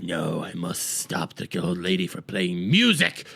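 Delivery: low, creaky villain voice; menacing Maestro. Acted. Maestro out to stop the old lady playing, low villain growl. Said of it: "Maestro hears it, goes like".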